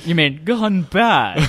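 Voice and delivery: slow, dopey mock-hero question; dim Batman parody. Batman slowly learning guns are wrong, mock dim voice. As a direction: draw it out like a slow-witted hero.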